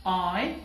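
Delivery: आई as clean ae glide; correct I. Shown as a demonstration. Teacher models correct आई for students. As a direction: say आई the English way. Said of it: "बल्कि ये है आई".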